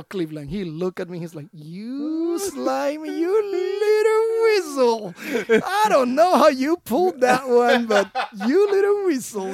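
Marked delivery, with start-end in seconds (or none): 1.5-9.5 slow, high mock scolding with a laugh; teasing general. General Cleveland ribbing him, slow high mock scold. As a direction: drag out the insult, half laughing.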